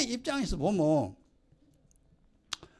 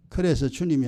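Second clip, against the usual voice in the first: ordinary delivery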